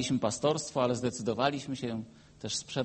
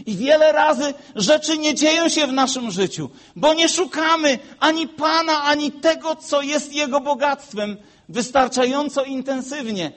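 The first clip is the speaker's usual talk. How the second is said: loud, high preaching climax; preaching at full force; 11 semitones higher, 8 dB louder than usual. Acted. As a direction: drive it home to the congregation. Preacher raises voice.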